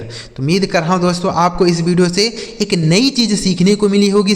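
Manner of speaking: normal talking voice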